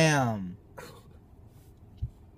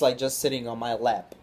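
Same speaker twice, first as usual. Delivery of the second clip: ordinary talk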